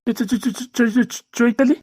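stuttering on the name in disbelief; stunned stammer. Acted. Father shocked to hear his son name the wife, stunned stammer. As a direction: stumble repeatedly before the name comes out.

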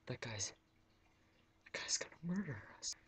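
frightened unvoiced whisper; scared whisper. Shoppers whispering fearfully about the hooded guy. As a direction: hiss it like terrified shoppers. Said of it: "the people in the store were like".

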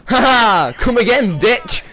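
high, loud mocking cackle and jeer; gloating taunt. Downed enemy mocking the player, high and loud.

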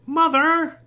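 high, loud, stretched-out cry; child's shout. A narrator's reading. Little chicken sees its sleeping mother, shouting. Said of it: "heard the little chicken shout".

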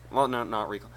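ordinary talk

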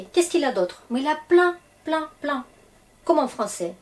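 ordinary talk

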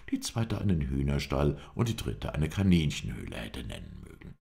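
ordinary delivery

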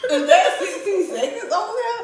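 high, loud, slow laughing shock; surprised laugh. Real, not acted. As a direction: laugh in disbelief at the clock.

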